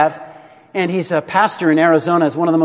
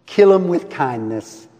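put-on light Southern accent; 2 semitones lower than usual. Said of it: "He said it with a Southern accent".

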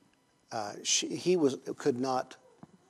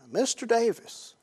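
boss calling out; 10 semitones higher, 5 dB louder than usual. Retold or imitated. Boss's call, higher.

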